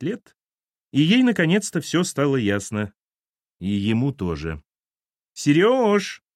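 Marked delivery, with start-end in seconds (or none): 5.3-6.2 high, drawn-out call; calling through door. A narrator's reading. Woman calls the man from behind the door, calling out. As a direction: call out to someone in another room. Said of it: "позвала из-за двери Инга".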